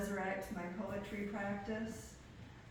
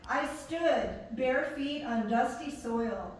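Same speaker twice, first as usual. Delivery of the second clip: measured poem recital, with pauses between phrases; poetry reading; 2 semitones higher, 5 dB louder than usual. Acted. Poet reading own work to an audience, measured poetry recital. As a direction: read it line by line, projected and deliberate.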